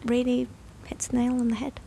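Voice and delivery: unvoiced soft whisper. Real, not acted. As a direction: barely breathe the words. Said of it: "you're getting softer".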